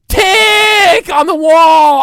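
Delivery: loud, high, slow shout of tick. Acted. Ranter shouts at the massage room clock. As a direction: bellow it like the clock is torturing you.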